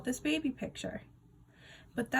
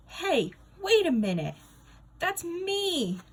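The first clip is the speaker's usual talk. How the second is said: shocked outburst; 10 semitones higher, 6 dB louder, slower than usual. Acted. Nate spotting his own baby picture, shocked and high. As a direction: voice jumps high in disbelief.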